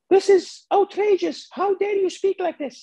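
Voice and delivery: gasp, then very high mock outrage; hammed-up falsetto indignation; outraged woman. Woman reacting to the lap dance demand, scandalized. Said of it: "she was doing that".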